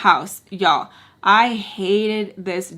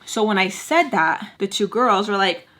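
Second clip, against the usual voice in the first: usual speaking voice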